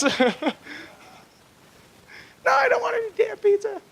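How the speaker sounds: hammed-up high-pitched wife refusal; angry wife. Wife's final refusal, acted.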